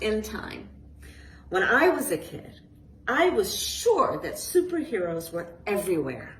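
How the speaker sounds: put-on lively storybook reading to kids; reading to children. A narrator's reading. Author reading her book aloud to a group of children, lively storytelling. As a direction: read it like a bedtime picture book.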